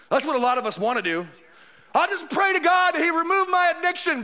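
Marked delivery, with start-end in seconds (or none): 2.0-4.2 high, sustained pious declaration; mock-pious believer. Preacher mimicking someone who only prays, mock-pious and high. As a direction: imitate an over-earnest believer, pitched up.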